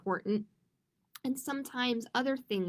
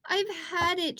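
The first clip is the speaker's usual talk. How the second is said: defeated sigh; 6 semitones higher than usual. A narrator's reading. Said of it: "she sighed".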